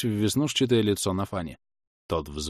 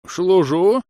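high, loud, uncertain salute; old villager; 10 semitones higher, 8 dB louder than usual. Acted. Old man answering his superior, loud and unsure.

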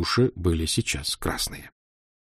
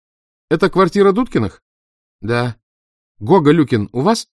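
phone woman; 7 semitones higher, 5 dB louder than usual. A narrator's reading. Woman on the telephone.

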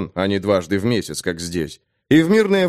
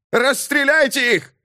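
high-pitched command to shoot; shouted order; 14 semitones higher than usual. Acted. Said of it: "крикнул мундир".

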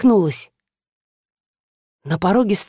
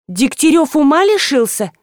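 higher, sharper outraged question; indignant attack; 6 semitones higher than usual. A narrator's reading. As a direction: pounce on her accusingly. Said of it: "накинулась на меня подруга".

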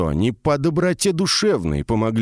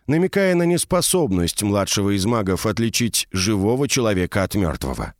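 ordinary delivery

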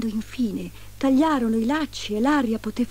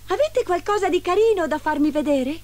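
put-on high, eager girl's voice; 10 semitones higher, 4 dB louder than usual. A narrator's reading. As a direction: ask it high and sweetly curious.

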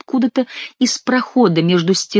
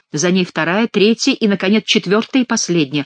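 usual speaking voice

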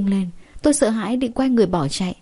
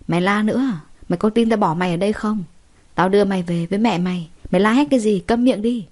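ordinary delivery

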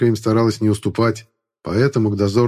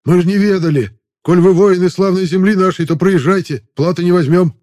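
higher, louder, eager to please; pleading guards; 9 semitones higher, 5 dB louder than usual. Acted. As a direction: beg him through, flustered.

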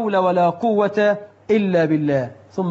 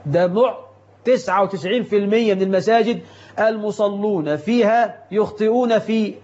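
usual speaking voice

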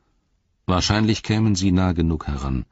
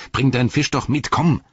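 higher-pitched, pressing call; urgent coaxing; 8 semitones higher, 4 dB louder than usual. A narrator's reading. Frodo to a stalling Gollum, urgently coaxing. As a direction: urge him along, rising to a sharp command.

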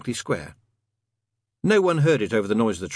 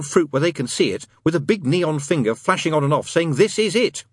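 higher-pitched demon in an eager rant; Crowley; 7 semitones higher, 3 dB louder than usual. Acted. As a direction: build an insistent argument to a high finish.

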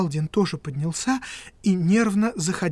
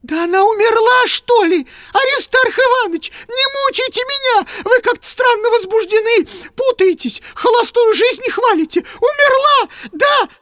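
high, loud, near-tearful pleading; distraught cry; 9 dB louder than usual. Acted. Kuvaldin begging Piskarev, terrified, close to tears. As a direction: voice cracks with dread. Kuvaldin panics about death.

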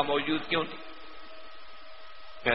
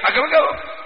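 scolding; 8 semitones higher, slower than usual. Retold or imitated. Camel driver scolds the scholar.

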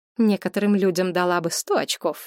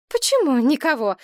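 higher, louder exclamation; exclaiming; 8 semitones higher, 4 dB louder than usual. A narrator's reading. Mother protesting that someone still remains, exclaiming. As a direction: cry it out in protest. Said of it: "воскликнула мать".

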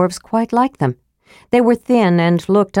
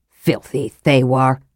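slow, low muttered contempt; fuming curse; 6 semitones lower than usual. A narrator's reading. Flint cursing the fled Thewar, low and fuming. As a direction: seethe over the words. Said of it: "Flint fumed".

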